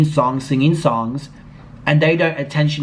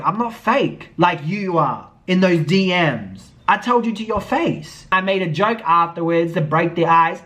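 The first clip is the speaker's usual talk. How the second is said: usual speaking voice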